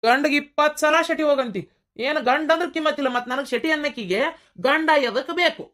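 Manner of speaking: high-pitched aggrieved protest; drunken indignant retort. Acted. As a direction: whine up high at his wife. Drunk husband argues with wife.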